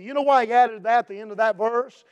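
ordinary talk, nothing marked

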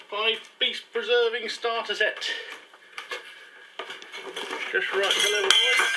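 mock announcer reading the box; advert voice. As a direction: sell it like a TV ad. Dad reads box label aloud.

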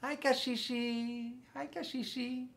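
high-pitched, soft hello; little-friend greeting. Pretend.

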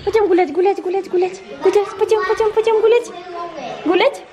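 high sing-song voice for the puppy; talking to a dog. Real, not acted. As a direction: coax the puppy excitedly. (сюсюкая с собакой)

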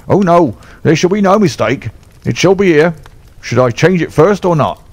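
a beaten bully grovelling to his master; frightened trainer fawning over Holmes; cringing trainer. Acted.

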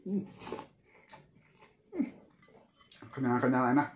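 chewing while speaking; muffled hums between bites; mouth full of noodles. Real, not acted. Seen 0: Noodles slurped into mouth, then cheeks full, chewing while humming.

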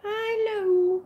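high, drawn-out hello; loud high-pitched hello; high greeting. Real, not acted. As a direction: sing out a long high hello. Calling a greeting to viewers.

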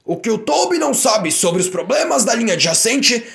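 voz de chefe alta e orgulhosa; chefe satisfeito. Acted. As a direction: elogia com orgulho. Chefe elogia Toby. (chefe elogia Toby, alto e orgulhoso)

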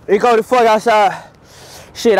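ordinary talk

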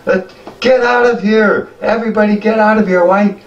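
put-on an old goblin screaming at the fleeing crowd; panicked old goblin. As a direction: warn them frantically in a high old voice. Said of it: "and he goes".

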